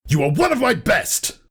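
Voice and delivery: high, sharp outburst of disbelief; snapping. A narrator's reading. Thunderhead to his sister, snapping angrily. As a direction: snap it at her in fury. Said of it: "Thunderhead snapped".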